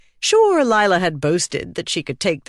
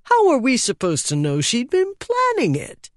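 high, plaintive indignation; aggrieved, high-pitched complaint; protesting; 9 semitones higher than usual. A narrator's reading. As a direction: whine it upward in protest. Lenos protesting the lost bet.

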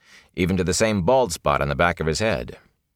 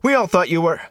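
high, loud call breaking off; shouted, cut off; 12 semitones higher, 4 dB louder than usual. A narrator's reading. Rand calling after the fleeing peddler, loud. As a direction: shout it, then stop short. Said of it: "he shouted".

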